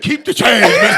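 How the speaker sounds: loud, high mock call; mock cashier shout. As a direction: yell it like a cashier. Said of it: "Motherfucker said".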